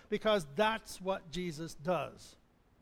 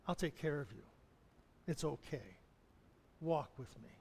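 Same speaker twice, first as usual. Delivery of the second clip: softer, hushed and tender; tender reassurance; 5 semitones lower, 9 dB quieter than usual. Pretend. Jesus comforting the listener, softly and tenderly. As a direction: reassure them gently like a hand on the shoulder. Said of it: "And he says to you".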